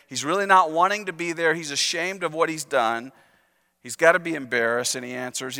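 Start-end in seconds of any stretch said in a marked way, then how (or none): none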